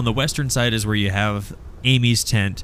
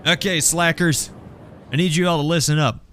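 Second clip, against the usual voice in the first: lightly voiced gang leader, pitched up; raised-pitch rallying announcement; Amy piping up; 8 semitones higher than usual. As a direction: call the slackers to attention. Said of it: "Amy pipes up and goes".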